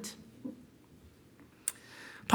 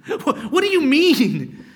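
incredulous, louder and higher, drawn out; mock bafflement; 5 semitones higher, 6 dB louder, slower than usual. Preacher voices Pilate puzzled by Jesus, baffled and incredulous. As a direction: laugh into a drawn-out, baffled question.

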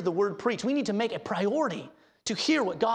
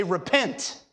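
stern rebuke; 4 semitones lower than usual. Shown as a demonstration. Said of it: "look you in the face and say".

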